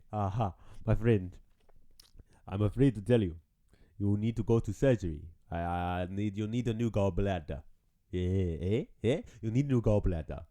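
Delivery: low-pitched African doctor voice; hammed-up African accent, deep and coaxing. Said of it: "you can't have an African doctor".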